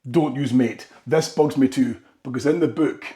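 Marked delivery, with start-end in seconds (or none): none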